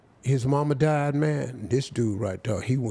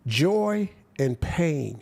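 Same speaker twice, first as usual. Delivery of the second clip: slow solemn quote; much slower than usual. Retold or imitated. Minister's words retold slowly.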